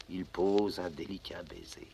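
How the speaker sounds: soft hushed whisper. A narrator's reading. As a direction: breathe the words out tenderly. Narrator hushes the kiss.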